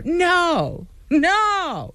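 high, falling "no"; indignant play-acted "no"; mock-angry refusal. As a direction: refuse point-blank, pitch jumping up. Acting out refusal in argument.

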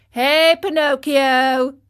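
crying out. A narrator's reading. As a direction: call him out from the gang. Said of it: "cried the tallest of them".

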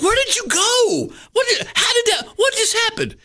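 amazed shout. Retold or imitated. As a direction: blurt out in disbelief. Kids amazed at Jerome's trick.

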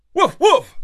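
high, loud happy woofs; excited dog barking; excited barks. A narrator's reading. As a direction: bark with joy before you catch yourself. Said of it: "said Marlowe excitedly".

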